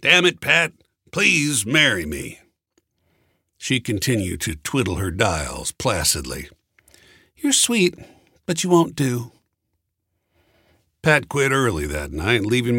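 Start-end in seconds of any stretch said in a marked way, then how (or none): 0.0-2.4 frustrated outburst then begging; a burst of frustration slowing to please; exasperated plea. A narrator's reading. Frustrated second proposal. 7.3-9.3 high, light woman's voice, brushing off; breezy higher refusal; Pat's dismissal. A narrator's reading. Pat turns him down.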